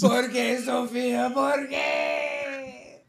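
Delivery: high, drawn-out mock cry; mock despairing wail. Crying out over Sophia's kick, mock despair. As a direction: wail it like hammed-up melodrama.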